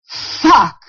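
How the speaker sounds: a retold word popping out clearly; clear as a bell. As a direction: imitate a sudden, clearly spoken outburst. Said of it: "As clear as a bell".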